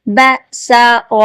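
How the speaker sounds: بسر drawn out syllable by syllable; slow syllables. Shown as a demonstration. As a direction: spell out بسر very slowly. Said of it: "ابھی ہم practice کی طرف آئیں گے".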